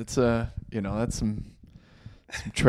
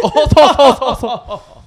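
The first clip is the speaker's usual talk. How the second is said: high hooting mock crowd laugh; imitating crowd laughter; 12 semitones higher, 5 dB louder than usual. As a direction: hoot like a laughing audience. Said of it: "The crowd's like".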